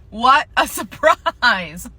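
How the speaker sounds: slow, loud mock amazement; sarcastic surprise. Cashier on a corporation that doesn't care, sarcastic. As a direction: draw it out with heavy sarcasm.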